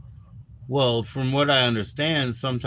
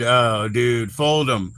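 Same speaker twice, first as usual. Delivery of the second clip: ordinary talk, nothing marked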